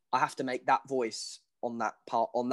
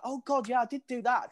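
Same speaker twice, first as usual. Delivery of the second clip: high-pitched retold "oh God, yeah"; sudden realisation; 9 semitones higher than usual. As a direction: remember it suddenly, voice jumping up high. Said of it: "and then you're like".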